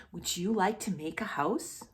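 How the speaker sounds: ordinary delivery, nothing marked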